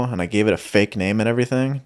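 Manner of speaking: usual speaking voice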